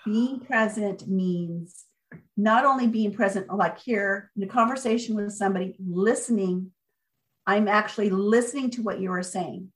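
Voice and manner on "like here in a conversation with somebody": ordinary delivery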